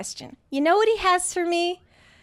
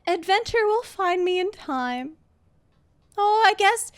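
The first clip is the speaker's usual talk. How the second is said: higher, sweetly put-on compliance; mock cheerful resignation; 4 semitones higher than usual. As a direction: play the forced smile, pitched up. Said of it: "with a smile on my face".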